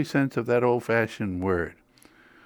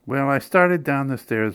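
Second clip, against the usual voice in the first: plain reading voice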